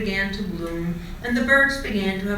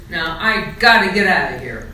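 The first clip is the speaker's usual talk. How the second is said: louder, firm brush-off; loud exit; 8 dB louder than usual. A narrator's reading. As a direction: say it loud and leave. Charlie snubs the rabbits.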